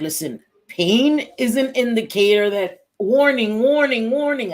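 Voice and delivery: loud, high, insistent alarm; urgent warning. Retold or imitated. Doctors warning a patient who waited four days, urgent warning. Acts out doctors scolding her.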